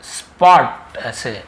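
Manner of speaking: स्पॉट said slowly, s sound drawn out; slow demo pronunciation. Shown as a demonstration. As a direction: drag out the स्पॉट example. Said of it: "स्कूल तो आधा सा होता है". (स्पॉट को धीरे-धीरे खींचकर बोलना)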